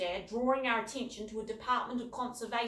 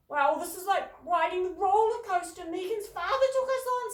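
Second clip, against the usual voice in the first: excited; 10 semitones higher, 10 dB louder than usual. A narrator's reading. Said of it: "forgetting to be British in her excitement".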